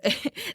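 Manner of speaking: quick breathy laugh; a small laugh slipping out; brief laugh; short laugh. Real, not acted. Laughs mid-story.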